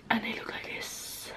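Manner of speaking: soft unvoiced whisper. Real, not acted. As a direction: breathe it out as a hushed whisper. Showing the lights to the camera.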